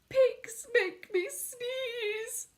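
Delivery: Gerald voice, high, drawn out; high, slow, mournful. Acted. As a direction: moan it slowly and high. Gerald complains, dejected.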